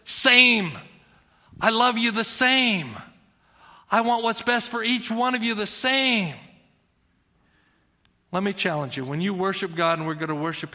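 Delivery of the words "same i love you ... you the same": high, loud, insistent same; God's voice, emphatic. Acted. Preacher voices God insisting he loves each equally. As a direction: press the word same home. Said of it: "God looks at you and he says".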